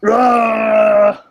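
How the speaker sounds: guttural rough orc roar. Acted. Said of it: "I'm doing the orc voice".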